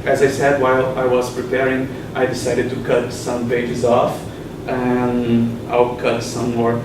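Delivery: normal talking voice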